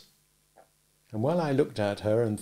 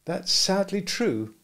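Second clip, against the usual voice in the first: put-on a higher, slow young woman's voice; Biddy, sadly agreeing with Pip, higher and slow; Biddy's gentle voice; 9 semitones higher, slower than usual. A narrator's reading.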